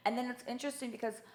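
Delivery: ordinary delivery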